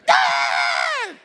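long, loud imitation of a kid's yell; drawn-out boyish shout; excited son. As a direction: holler like an excited child. Imitates his bouncing son bursting in.

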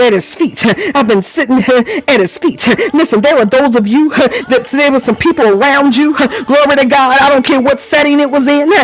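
rising, fervent preacher cadence with shouted cries; preaching at full force. Acted. Preacher stirring up the congregation, fervent and shouting.

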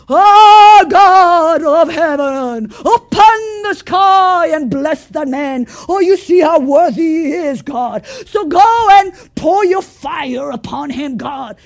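loud, slow, sing-song showy prayer; hammed-up grand prayer, calling to heaven; pompous prophet. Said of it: "the prophet was gonna come with all of his pomp".

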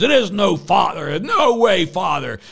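loud, high furious shouting, slowing toward the end; angry yell. Retold or imitated. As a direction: bark it back like the angry hospital guard. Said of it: "the guy yelled at me".

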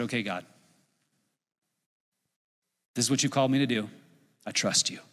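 low, softened, yielding prayer; soft, resigned trust; quiet surrender. Retold or imitated. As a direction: give in gently, dropping pitch and volume. Retold surrender to God in prayer.

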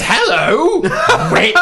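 high, sing-song fake politeness; mock sweet greeting. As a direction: greet her sweetly with a sneer underneath. Mocking greeting to shop worker.

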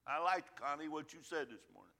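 normal talking voice

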